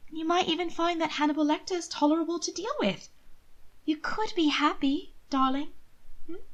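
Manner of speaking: a sister coaxing her brother gently; pleading sister. A narrator's reading. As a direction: beg him sweetly. Mina persuading.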